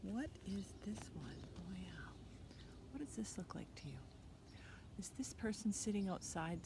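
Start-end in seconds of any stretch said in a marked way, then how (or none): 0.0-4.0 whispering. Acted. Aside to children.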